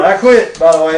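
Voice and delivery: loud joke. Real, not acted. Joking aside, louder.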